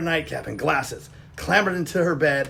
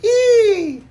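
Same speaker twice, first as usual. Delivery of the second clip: high startled shriek; frightened squeal; 9 dB louder than usual. Acted. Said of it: "jumped with fright".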